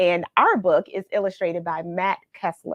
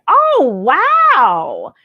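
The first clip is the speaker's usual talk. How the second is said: surprised wow; 6 semitones higher, 10 dB louder than usual. Real, not acted. Reacting to viewer's family story.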